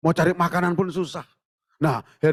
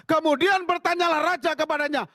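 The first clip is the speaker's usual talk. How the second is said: scripture reading; 13 semitones higher than usual. Acted. Pastor reading the verse to the congregation, high lifted reading.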